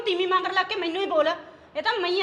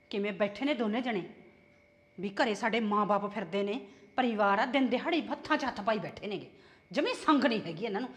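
lower, softer muttered grumble; grumbling aside; 9 semitones lower, 7 dB quieter than usual. Acted. Mother-in-law grumbling to herself about the couple, low mutter. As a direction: grumble under your breath while watching the couple.

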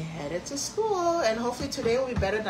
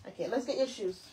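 normal talking voice

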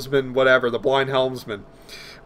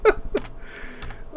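breathy laugh; 7 dB quieter than usual. Real, not acted. Laughs after the impression.

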